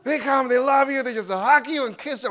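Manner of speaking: ordinary delivery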